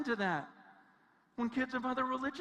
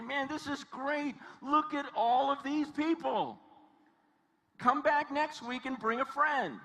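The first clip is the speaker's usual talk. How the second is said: mock-thrilled, higher-pitched crowd-pleaser; excited; 3 semitones higher than usual. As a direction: gush over the turnout in an imagined quote. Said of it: "He doesn't get excited".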